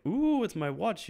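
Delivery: high, drawn-out gift reaction; delighted surprise. Retold or imitated. Father unwraps watch from daughter, delighted.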